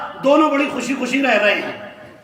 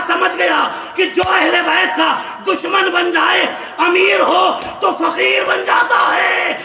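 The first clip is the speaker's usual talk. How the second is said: a preacher crying out the story's moral to the crowd; preaching at full force; 6 semitones higher than usual. Acted. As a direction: build the sermon to a fervent, shouted peak. Climax of the majlis story.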